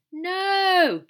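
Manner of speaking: loud high heckler's shout. Acted. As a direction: bark a loud no from the crowd. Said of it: "shouted someone".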